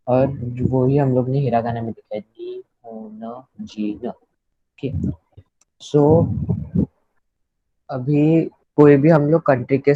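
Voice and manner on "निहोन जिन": निहोन जिन, धीरे और शांत, हर अक्षर अलग; निहोन जिन को धीरे-धीरे पढ़कर बताएं; Japanese pronunciation. Shown as a demonstration. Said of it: "So निहोन जिन ऐसा बोलते हैं". (धीमे जापानी उच्चारण में निहोन जिन)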